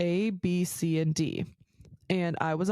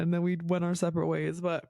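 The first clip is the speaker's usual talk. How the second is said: ordinary delivery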